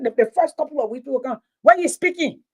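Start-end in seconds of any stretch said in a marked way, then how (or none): none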